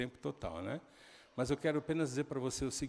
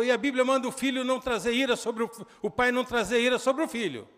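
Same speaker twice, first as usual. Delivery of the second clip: acted angry shout; 9 semitones higher, 9 dB louder than usual. Son retold shouting back.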